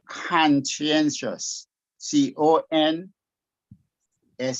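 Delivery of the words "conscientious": "conscientious" slowed, broken into clear syllables; pronunciation demo. Shown as a demonstration. Teacher models the unsure student's word, pronunciation demo. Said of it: "I'm not sure if you say".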